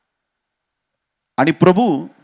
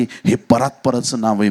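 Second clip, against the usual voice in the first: ordinary delivery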